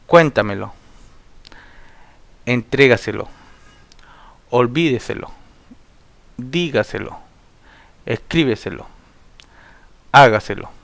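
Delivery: "cuéntamelo, hágaselo" with early accented syllable leaned on, the rest trailing; stress before antepenultimate syllable. Shown as a demonstration. Teacher reciting sobresdrújulas like "entrégaselo", stressing well before the word's end. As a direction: lean on the accented vowel in "dígaselo", "escríbeselo". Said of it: "llevan el acento en la antepenúltima".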